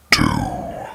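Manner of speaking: very low, drawn-out monstrous announcer; spooky countdown voice. Acted. Narrator announces the next creepy story, deep spooky countdown. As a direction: drop deep and stretch the number out.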